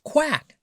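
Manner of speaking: very high quack; duck quack. A narrator's reading. Reading to children, a very high duck quack. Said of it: "said the duck".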